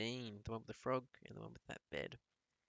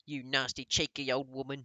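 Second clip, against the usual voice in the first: louder insulting snarl; angry shout; 8 dB louder than usual. A narrator's reading. Father shouting insults at the grandmother, angry. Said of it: "shouted Mister Jenkins".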